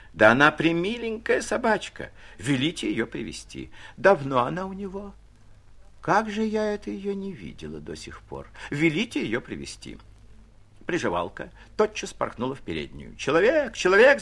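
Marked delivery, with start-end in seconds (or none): 0.1-10.0 выше и громче, умилённо восхищаясь; барыня умиляется собачкой и приказывает; восторженная барыня. A narrator's reading. 13.2-14.2 высокий громкий зов прислуги; кричит слугу через весь дом; крик в переднюю. A narrator's reading. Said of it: "закричала она".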